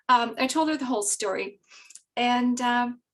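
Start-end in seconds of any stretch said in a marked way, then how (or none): none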